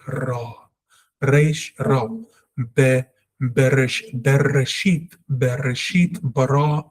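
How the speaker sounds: "Bereshit" and "Bara" with trilled R; rolled R. Shown as a demonstration. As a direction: vibrate the tongue on every R, as in "Reish", "Ra". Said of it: "The tongue must vibrate".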